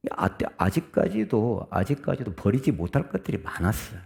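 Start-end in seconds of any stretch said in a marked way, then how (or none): none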